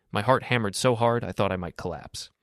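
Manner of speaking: usual speaking voice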